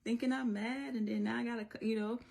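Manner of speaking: high-pitched exasperated mom; imitated fed-up mommy complaint; a little frustrated. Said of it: "I get a little frustrated".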